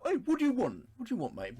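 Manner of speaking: a loud, high, casual challenge; mock blunt what do you want, mate. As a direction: toss it off like a bloke at the pub. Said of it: "can't help but read this as that kind of like".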